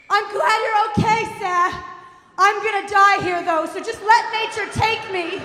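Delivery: high, loud melodramatic wail; hammed-up screaming mom; screaming mother. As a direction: shout a doomed farewell. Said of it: "she's screaming".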